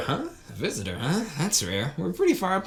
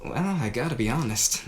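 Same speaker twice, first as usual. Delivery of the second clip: normal talking voice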